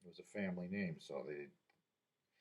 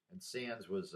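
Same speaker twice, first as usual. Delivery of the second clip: normal talking voice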